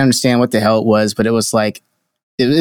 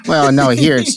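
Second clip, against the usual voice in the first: usual speaking voice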